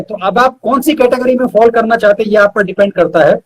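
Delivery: ordinary talk, nothing marked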